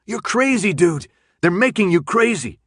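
high, loud frantic shout; desperate shouted plea. Acted. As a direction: yell it right in his face. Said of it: "Minho shouted back".

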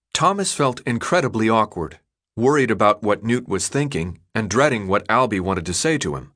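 plain reading voice

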